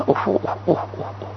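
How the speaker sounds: hushed, voiceless "oh, oh, oh"; mock dismayed oohs, fading away; shocked "ooh". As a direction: puff out appalled oohs under the breath. Acts shocked listeners' reaction.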